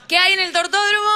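rally the crowd to answer; crowd call-out. Real, not acted. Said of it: "Vamos todos juntos". (arengando al público, fuerte y agudo)

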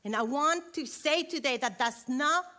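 rally the moms from the pulpit; pastor's wife exhorting mothers not to give up, in full preaching; preaching at full force. Acted. Back to preaching.

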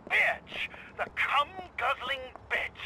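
furious rant. Acted. Husband ranting about wife.

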